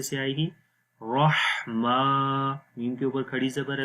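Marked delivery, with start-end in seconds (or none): 1.0-2.5 slow رحمہ with thick 'ra' and mid-throat h; heavy raa. Shown as a demonstration. Teacher showing throat letters, رحمہ said slow and heavy. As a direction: round the first sound of رحمہ, hold the last vowel. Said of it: "اب را کو موٹا پڑھیں گے".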